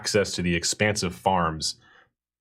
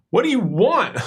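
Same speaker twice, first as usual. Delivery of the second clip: annoyed townsperson; 12 semitones higher, 4 dB louder than usual. Acted. NPC annoyed reply.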